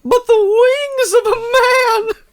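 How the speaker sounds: high, loud squeaky voice; high silly voice. Pretend. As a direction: squeak it out like a cartoon bird. Playful creature voice.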